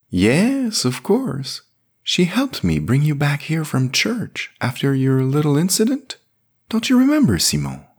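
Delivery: put-on high, fond voice of an old priest; gentle old priest Sébastien. A narrator's reading. Sébastien's voice in the story.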